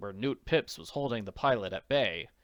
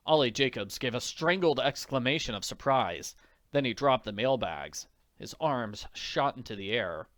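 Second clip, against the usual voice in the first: plain reading voice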